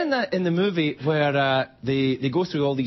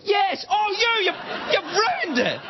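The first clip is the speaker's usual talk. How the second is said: mock outrage; slower than usual. Outraged at kid with glasses.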